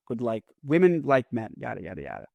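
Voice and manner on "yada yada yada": ordinary talk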